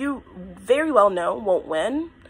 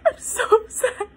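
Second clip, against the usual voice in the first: high, tearful voice breaking with sobs; overwhelmed, crying hard in a very high pitch; crying, real breakdown; 13 semitones higher than usual. Real, not acted. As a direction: push the words out through real tears. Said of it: "I'm getting emotional".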